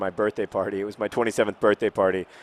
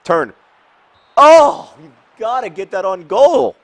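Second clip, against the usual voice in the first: very high, loud cry of frustration; exasperated shout; 11 semitones higher, 14 dB louder, slower than usual. Real, not acted. Gamer yelling at his team over a missed shot, exasperated shout. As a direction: yell at your players in anguish.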